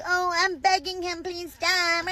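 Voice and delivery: lightly voiced whiny farmer, mock begging; mock pleading farmer. Comedian mimicking a begging farmer, mild whine. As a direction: squeak out a mock plea. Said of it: "And now you're like".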